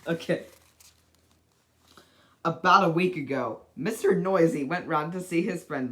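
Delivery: ordinary delivery, nothing marked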